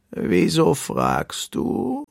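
very slow, stretched turtle question; deep-voiced turtle. A narrator's reading. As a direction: ask it ponderously, word by word. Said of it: "eine tiefe Stimme".

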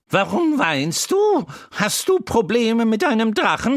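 put-on a chatty horse teasing the crying boy; Troubadour the talking horse. A narrator's reading. Horse Troubadour comforting the crying boy Wendel, high and loud. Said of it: "Das Pferd stupste Wendel mit der Nase an und fragte".

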